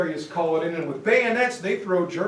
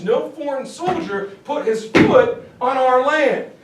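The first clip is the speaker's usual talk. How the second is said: acted-out indignant German defiance, raised voice; defiant protest; 4 semitones higher, 5 dB louder than usual. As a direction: argue back louder and higher, mock-indignant. Acting out Germans who deny defeat.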